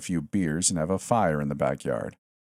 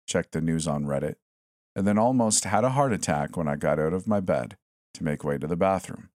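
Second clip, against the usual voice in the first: plain reading voice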